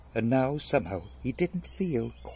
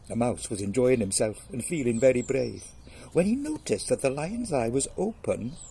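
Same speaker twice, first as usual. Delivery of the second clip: ordinary delivery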